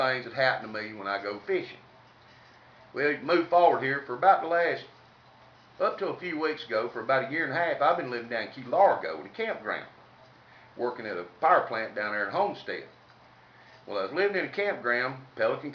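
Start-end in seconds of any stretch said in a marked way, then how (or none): none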